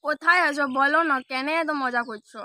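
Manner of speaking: ordinary talk, nothing marked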